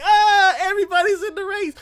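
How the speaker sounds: high, loud mock excitement; thrilled squeal. Viewer seeing everybody in the race, thrilled. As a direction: cry out in hammed-up delight.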